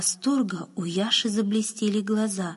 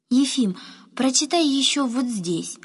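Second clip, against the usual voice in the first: put-on higher, louder boyish plea; eager request; 5 semitones higher, 3 dB louder than usual. A narrator's reading. Boy points groom to another line, eagerly pleading. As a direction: ask him keenly to read on. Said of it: "попросил Яша".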